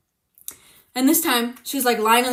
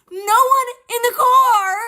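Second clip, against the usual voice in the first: incredulous; 12 semitones higher, 5 dB louder, slower than usual. Retold or imitated. Disbelief at mom's claim.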